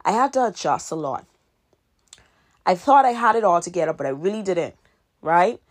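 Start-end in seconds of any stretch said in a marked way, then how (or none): none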